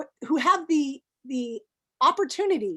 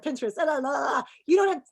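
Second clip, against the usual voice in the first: ordinary talk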